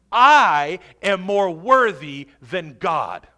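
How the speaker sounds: loud, slow, hammering the I; defiant self-exaltation. Acted. Preacher mimics the unforgiving believer, loud and defiant. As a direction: proclaim it boldly, word by word.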